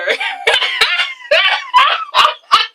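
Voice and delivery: a real high shrieking burst of laughter. Real, not acted. After joking about the dead, a real shrieking laugh. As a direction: let a high squeal of laughter out. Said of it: "That's not funny".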